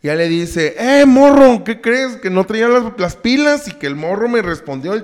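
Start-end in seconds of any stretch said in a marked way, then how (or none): 0.8-3.7 higher, louder rushed telling; excited high mother. Retold or imitated. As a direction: blurt it out excitedly. Mother tells detective excitedly.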